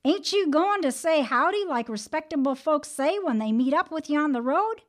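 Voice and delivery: high, loud indignant scolding; hollering. A narrator's reading. Brer Rabbit hollering at the rude tar baby, high and loud. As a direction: scold it for bad manners. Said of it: "hollered at the tar baby even louder".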